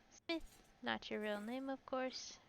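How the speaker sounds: soft, tentative "not your real name, of course"; quiet first read. Shown as a demonstration. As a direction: test the line under your breath.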